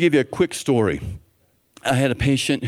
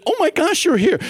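very high, imitated delighted greeting; excited greeting; 3 dB louder than usual. Pharmacist greets the patient she expected, excited greeting. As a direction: gush as the thrilled pharmacist.

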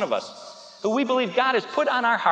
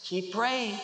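quiet encouragement; 7 dB quieter than usual. Acted. God's answer after the outburst.

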